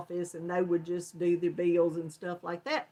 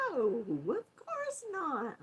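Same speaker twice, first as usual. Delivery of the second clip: innocent denial; 5 semitones higher, 6 dB quieter, slower than usual. Retold or imitated. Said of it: "And I'm like".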